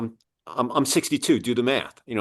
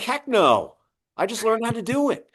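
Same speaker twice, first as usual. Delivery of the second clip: mock alarmed protest; 13 semitones higher than usual. Scared reply to the boss.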